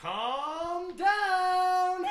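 high, long-held singsong; mock soothing cry. Castmate telling an upset housewife to settle, high singsong. Acted scene, drawn-out high sung call.

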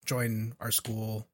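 quiet, low and tender; soft pleading. Retold or imitated. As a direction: plead gently with him. Voicing hero pleading gently.

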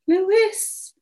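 high call that doesn't carry; weak calling out. A narrator's reading. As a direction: try to call out without full volume. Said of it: "nowhere near loudly enough to be heard".

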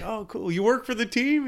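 high, eager passerby question; curious stranger voice. Retold or imitated. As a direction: voice an excited fan asking. Stranger voice continued.

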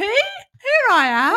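slow, high doctor voice. Pretend. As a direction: play the cheery GP. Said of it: "G P".

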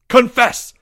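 high, loud commanding shout; thundered. Acted. Leo grabbing Porge by the shirt, thundered demand. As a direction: thunder it as an order. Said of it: "he thundered".

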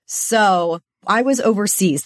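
usual speaking voice

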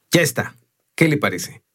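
pleased announcement, starting high; evident pride. A narrator's reading. Stonemason showing his finished stone to the visitor, proud. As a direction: proudly present the finished work. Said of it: "dijo con un aire de orgullo evidente".

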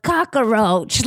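drawn-out cockroach in an imitated American accent; imitated American accent, slow and relished; Natasha Lyonne. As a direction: stretch out each syllable, mimicking an American accent. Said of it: "listening to the way that Natasha Lyonne".

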